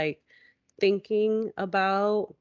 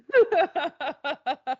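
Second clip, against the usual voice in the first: high burst of real laughter; helpless, high-pitched laugh; 10 semitones higher, 4 dB louder than usual. Real, not acted. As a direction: laugh out loud at yourself. Laughing at her own excuse.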